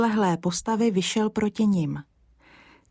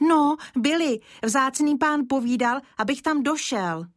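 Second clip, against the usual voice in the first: lightly voiced child; 7 semitones higher than usual. Child answers gamekeeper.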